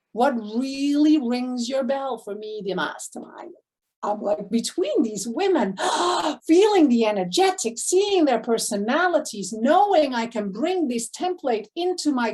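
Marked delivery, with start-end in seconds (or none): 5.8-6.4 sharp gasp of delight; a quick in-breath of thrill; excited gasp. Real, not acted. Coach gasping in delight at the women's energy.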